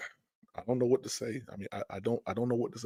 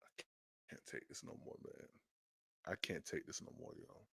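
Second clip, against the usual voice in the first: defeated whisper; 8 semitones lower, 26 dB quieter than usual. Real, not acted.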